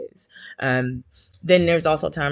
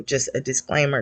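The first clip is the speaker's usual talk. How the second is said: usual speaking voice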